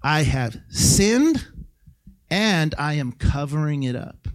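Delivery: slow confession. Retold or imitated. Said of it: "Adam had to confess".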